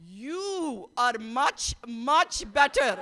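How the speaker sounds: slow, emphatic, rising to loud; imitating proud mom. Mom answering the vice president, slow and emphatic. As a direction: drag out each word like a proud elder. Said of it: "my mom responds by saying".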